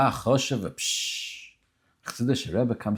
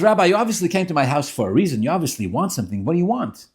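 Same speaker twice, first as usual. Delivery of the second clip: raised, insistent acted questioning; exasperated demand; 6 semitones higher, 5 dB louder than usual. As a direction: press the silent rabbi for an answer. Rich man presses silent rabbi.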